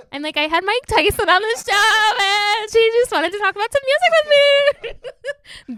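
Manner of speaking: slow, very high, hammed-up voice; high mock voice. Host joking about a celebrity guest, high drawn-out put-on voice. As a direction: drag it out way up high, breaking into a laugh.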